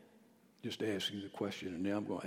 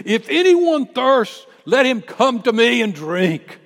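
loud, high shout to the crowd; Jesus shouting; 11 semitones higher, 19 dB louder than usual. Acted. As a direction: cry it out over thousands of people. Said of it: "He shouted this".

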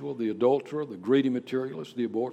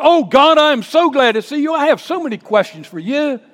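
loud, high, hurried mock delight; mock gushing; 11 semitones higher, 14 dB louder than usual. Isaiah supposedly greeting God with many questions, mock gushing. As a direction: gush like an overeager fan, hammed up. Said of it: "How does Isaiah respond?".

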